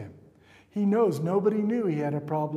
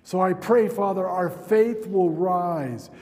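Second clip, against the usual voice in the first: rising, louder pastoral prayer over music; fervent prayer; 5 dB louder than usual. Real, not acted. Pastor praying over the church, fervent and rising. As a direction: pray it with building authority.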